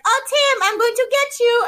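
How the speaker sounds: high, loud playful taunt; teasing Heidi. Retold or imitated. Heidi taunting Tim while fencing, high and playful. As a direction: squeal a mock threat. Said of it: "she's like".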